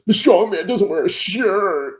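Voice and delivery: high, slow put-on voice mocking a commenter. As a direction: drawl out the hater's line like a silly troll. Reads a hater's comment mockingly.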